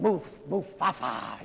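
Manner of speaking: slow, hammed-up idiot hyena impression; iene sceme. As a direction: goof around like a dim-witted hyena, drawn out. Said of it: "they're being idiots. They're going".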